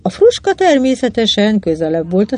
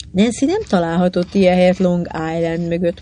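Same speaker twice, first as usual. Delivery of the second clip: ordinary delivery, nothing marked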